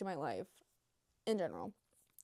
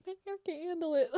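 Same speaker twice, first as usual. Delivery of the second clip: higher, fast whiny complaint; mocking their complaint; 8 semitones higher, 5 dB louder, faster than usual. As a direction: mimic a dramatic complaint. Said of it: "they're like".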